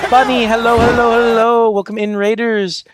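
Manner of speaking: high, loud cheery welcome; excited greeting. Real, not acted. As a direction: call out hello brightly. Greeting incoming raid.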